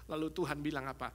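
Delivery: ordinary talk, nothing marked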